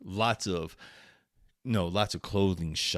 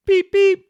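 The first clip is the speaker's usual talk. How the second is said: mock car horn; 13 dB louder than usual. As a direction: beep beep squeaked very high and loud. Honking outside her apartment.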